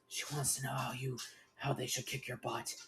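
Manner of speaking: very soft, hushed aside; whisper. A narrator's reading. Spike explains the threat to Aaron, whispering. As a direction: drop to a hushed undertone. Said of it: "Spike whispers".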